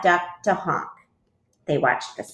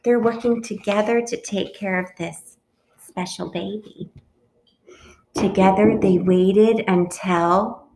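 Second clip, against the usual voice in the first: ordinary delivery, nothing marked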